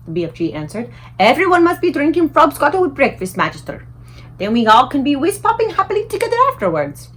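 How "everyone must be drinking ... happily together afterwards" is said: higher, louder giant enthusing; the giant pleading happily; eager giant voice. A narrator's reading. The BFG eagerly telling the Queen about frobscottle, higher and louder.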